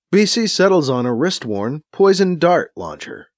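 ordinary delivery, nothing marked

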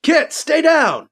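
high, urgent yell. A narrator's reading. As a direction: yell the warning across. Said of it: "Alibi yells over at him".

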